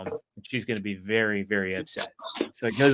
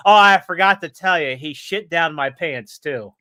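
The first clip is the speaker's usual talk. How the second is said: punchline excuse; 4 semitones higher, 8 dB louder than usual. Retold or imitated. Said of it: "He goes".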